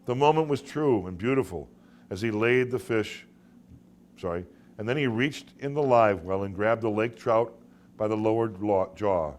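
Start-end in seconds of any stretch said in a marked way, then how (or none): none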